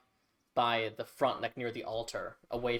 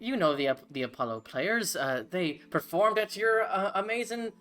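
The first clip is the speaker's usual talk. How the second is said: higher, louder, bubbly gnome voice; eager gnome cleric; 8 semitones higher, 4 dB louder than usual. Acted. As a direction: gush like a flustered fan meeting heroes. Gnome keeps talking.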